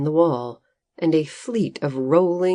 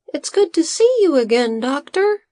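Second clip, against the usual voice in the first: high, light little assistant voice; a small worried helper explaining; Bodo's high voice; 11 semitones higher, 4 dB louder than usual. A narrator's reading. Assistant telling the farmer his master is affected, high voice.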